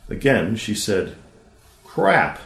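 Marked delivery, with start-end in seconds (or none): 1.8-2.4 bigger, higher blurt; louder exclamation. Acted. Girl says crap louder as her brother might, bolder exclamation. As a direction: push the word out louder than before, still a child. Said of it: "but louder, a bigger exclamation point".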